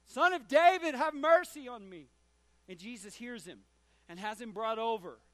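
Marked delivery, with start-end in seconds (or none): 0.0-2.1 loud pleading cry, much higher. Acted. Blind beggar ignoring the crowd, pleading to Jesus: loud cry. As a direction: plead louder despite the hushing. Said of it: "cried all the more".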